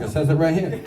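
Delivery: ordinary delivery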